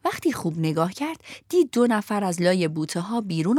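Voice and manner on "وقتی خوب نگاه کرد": plain reading voice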